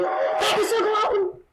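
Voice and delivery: slow, loud, laughing delight. Real, not acted. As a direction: laugh out the wonder.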